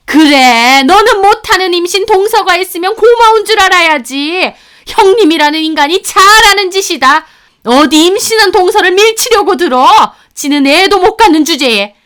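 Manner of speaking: loud, high mother-in-law tirade; scornful scolding. Acted. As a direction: berate them with contempt. Mother-in-law berating the narrator.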